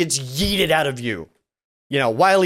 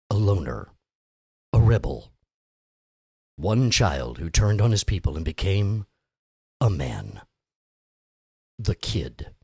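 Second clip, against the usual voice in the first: movie trailer voice; 10 semitones lower than usual. Acted. Host doing a movie trailer for the bickering crew, deep and dramatic. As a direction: sell the summer blockbuster like a trailer voiceover. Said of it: "I gotta get into the characters".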